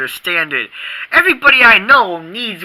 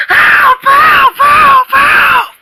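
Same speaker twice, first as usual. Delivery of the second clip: high, loud cries for help; shouting for help; 7 dB louder, much slower than usual. Acted. Kid in trouble shouting help to anyone. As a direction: yell help over and over, panicked.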